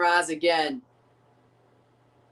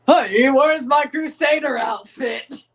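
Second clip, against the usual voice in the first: hammed-up call to the wife, louder; mock husband call; 5 semitones higher, 6 dB louder than usual. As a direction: play a knight asking his wife. Mock wife-to-husband Crusader quote.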